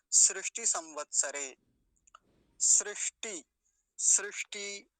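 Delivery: सृष्टि said "srishti", loud and distinct; emphatic model of सृष्टि with "ri"; correct pronunciation. Shown as a demonstration. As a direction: hit सृष्टि hard with an i after the r. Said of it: "ये वाली बात भी बताई थी".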